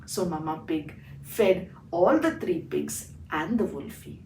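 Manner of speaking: usual speaking voice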